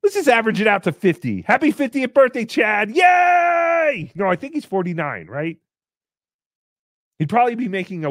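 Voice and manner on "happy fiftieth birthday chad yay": high, loud celebratory shout; hammed-up party cheer; mock birthday cheer. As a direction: cheer it out, stretching the yay.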